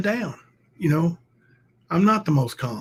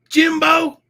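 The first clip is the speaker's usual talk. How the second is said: higher, louder scared squeak; mock scared rival; 12 semitones higher, 8 dB louder than usual. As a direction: squeal the name in mock fright. Mimicking rival's scared call.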